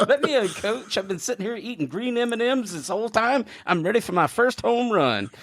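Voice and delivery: put-on high eager little-league kid begging the coach; kid player voice. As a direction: beg the coach in a squeaky kid voice.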